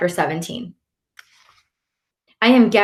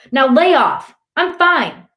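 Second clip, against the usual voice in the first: high, defensive yell easing in volume; 9 semitones higher, 4 dB louder than usual. A narrator's reading. Boy telling his brother to lay off, yelling. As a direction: push back hard in short bursts. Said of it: "I yell".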